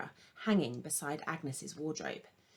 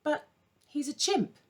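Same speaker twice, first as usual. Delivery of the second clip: put-on higher, stunned girl's objection; shocked disbelief; 8 semitones higher, 4 dB louder than usual. A narrator's reading. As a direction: she can barely believe it. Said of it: "struck dumb with shock".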